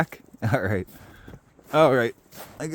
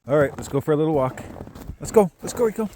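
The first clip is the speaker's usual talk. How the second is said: calling a dog; 5 semitones higher, 3 dB louder, faster than usual. Real, not acted.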